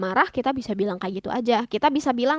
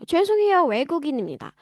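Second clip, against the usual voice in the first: natural conversational demo; 4 semitones higher, much faster than usual. Shown as a demonstration. Foreigner excusing themself to a Korean, brisk apology. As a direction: say 죄송해요, 외국인입니다 at everyday speed. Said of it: "Kayak gitu".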